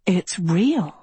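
awed, with a swooping lift on the last word; gasping in awe. A narrator's reading. Agatha gasps at castles far below, awed. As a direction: let amazement swell on the final word. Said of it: "and gasped".

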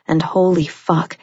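plain reading voice

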